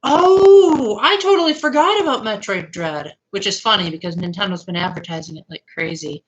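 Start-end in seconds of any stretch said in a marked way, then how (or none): 0.0-3.2 sudden realization. Real, not acted. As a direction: react as if it just came back to you. Suddenly reminded of forgotten game.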